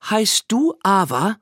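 teacher's pointed question, high and slow; Miss Greenberg. A narrator's reading. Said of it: "fragte Miss Greenberg ihn".

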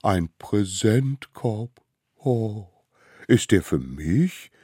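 slow, delighted exclamation; freudiger Ausruf. A narrator's reading. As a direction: savour the surprise of a gift. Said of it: "rief es". (langsam und freudig staunend)

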